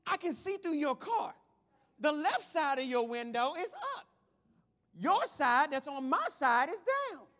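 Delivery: high-pitched acted exasperation; comic indignant griping; venting. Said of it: "Thank you for letting me vent".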